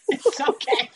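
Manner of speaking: laughing while talking. Real, not acted. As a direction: giggle through it. Laughing at high friend.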